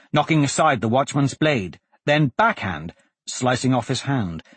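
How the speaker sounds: plain reading voice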